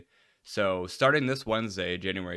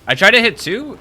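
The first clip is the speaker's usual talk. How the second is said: loud whiny defence; 13 semitones higher, 14 dB louder than usual. Real, not acted. Defending a failed attempt.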